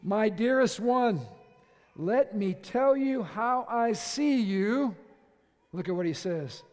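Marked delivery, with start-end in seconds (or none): 0.0-4.9 slow, loud declaration of love; raised, drawn-out romantic address; man addressing his beloved. Acted. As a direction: proclaim it grandly to a beloved. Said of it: "This is the man speaking to the woman".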